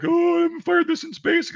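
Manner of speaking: high-pitched mock whine of rookies; mock new-guy worry. Voicing nervous new guys.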